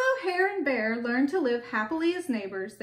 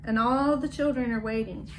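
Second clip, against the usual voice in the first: usual speaking voice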